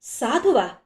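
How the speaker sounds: surprised echo with a rising swoop; narrated gasp of astonishment, voiced as a question; astonished question. A narrator's reading. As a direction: ask it in disbelief. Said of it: "అందరూ ఒక్కసారే ఆశ్చర్యంగా అన్నారు".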